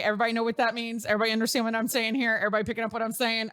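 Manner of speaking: brisk run of quick questions; rapid-fire checking. Real, not acted. Checking the audience gets her innuendo, rapid-fire. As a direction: rattle the questions off without a breath.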